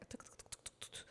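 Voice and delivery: tuk tuk tuk clicked out voicelessly like keys; imitating typing while the customer talks; whispered typing noise. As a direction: mimic fast keyboard typing in a breathy click.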